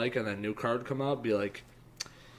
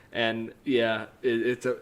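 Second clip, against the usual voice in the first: ordinary talk, nothing marked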